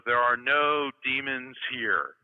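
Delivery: slow, loud mock-solemn verdict; solemn proclamation. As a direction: announce it grandly, word by word. Teller's solemn verdict to the brujo.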